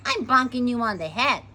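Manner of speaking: loud, defiant warning; defiant threat. A narrator's reading. Girl about to hit the tiger, loud and defiant. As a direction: announce the attack loudly.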